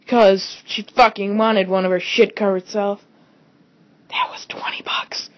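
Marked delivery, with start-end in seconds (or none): 4.1-5.3 whispered aside. Real, not acted. Bitter aside about the cost.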